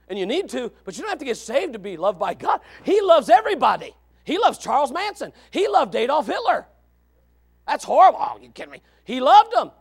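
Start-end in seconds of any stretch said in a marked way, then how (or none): 2.8-9.7 louder, higher, hammering each line; emphatic preaching. Real, not acted. Preacher insisting God loved even the worst, loud. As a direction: drive it home to the pews.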